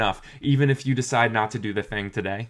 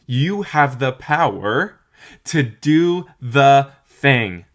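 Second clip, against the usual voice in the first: slow, much louder, every word punched; emphatic declaration; 3 semitones higher, 9 dB louder, much slower than usual. Real, not acted. Telling viewers they can do it, slow and much louder. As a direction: drive it home, word by word.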